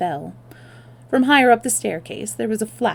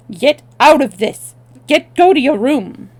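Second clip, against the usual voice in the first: loud barked order; angry shout; 6 semitones higher, 10 dB louder than usual. Acted. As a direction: shout her away. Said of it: "turned on her with an oath".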